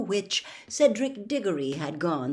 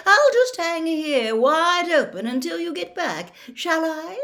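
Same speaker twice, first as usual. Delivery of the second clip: high, loud indignant sarcasm; irritable call; 10 semitones higher, 6 dB louder than usual. A narrator's reading. Fat lady left hanging open, calling irritably. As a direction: complain loudly after them, voice pitched up. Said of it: "called irritably after them".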